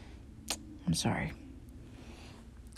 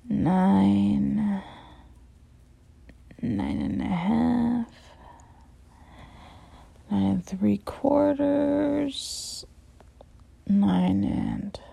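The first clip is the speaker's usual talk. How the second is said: mock counting in fractions; drawn-out mocking count; much slower than usual. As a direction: stretch each number slowly, teasing.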